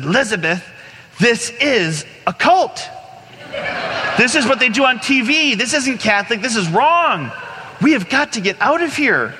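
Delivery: high-pitched, hammed-up scandalized panic; alarmed warning. Man warning friend they're in a cult, high mock alarm.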